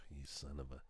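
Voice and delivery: very low, hushed muttering; muttered curse. Retold or imitated. Dad cursing his son on the ride, muttered curse. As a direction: curse him under the breath.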